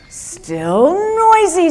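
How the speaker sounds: high, slow, whiny complaint; Peter complaining. Acted. Peter grumbles to the wise man, slow and high. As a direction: drag out the grumble like a fed-up villager.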